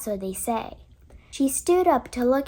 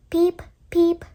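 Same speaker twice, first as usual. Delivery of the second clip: high little peeping cry; baby duckling peep; 4 semitones higher, 4 dB louder than usual. A narrator's reading. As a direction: peep like a newborn chick. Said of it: "cried the baby".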